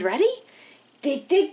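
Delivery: ordinary talk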